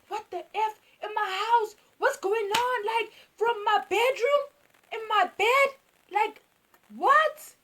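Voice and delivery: high, loud mock outrage; hammed-up incredulous anger; mother exploding. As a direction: imitate a furious mom, pitch climbing to the last word. Mother explodes at bedroom discovery.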